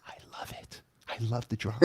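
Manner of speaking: barely voiced, very soft aside; conspiratorial whisper. Host confiding to viewers while co-host is backstage, conspiratorial whisper. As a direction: whisper it gleefully behind his back.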